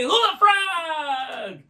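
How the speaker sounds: long, high shouted chant; shouting magic words. Real, not acted. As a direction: call it out to the crowd.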